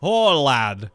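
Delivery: loud, clumsy Scottish brogue, falling on the last word; bad Scottish accent. Co-host repeating his Connery line when asked, bad Scottish accent. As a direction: attempt a Scottish accent and do it badly. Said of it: "I can't do a Scottish accent".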